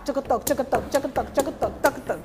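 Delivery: rhythmic mouth drumming "tchucutoc"; drum imitation. Shown as a demonstration. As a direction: imitate an atabaque beat with the mouth. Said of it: "alguém tocando atabaque". (imitando batida de atabaque)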